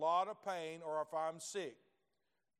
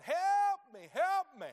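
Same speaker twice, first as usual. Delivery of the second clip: high, loud desperate cry of help me; patient in the next bed hollering for help; 14 semitones higher, 11 dB louder, slower than usual. Retold or imitated. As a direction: call out in pain to the passing nurses. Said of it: "he's over there hollering".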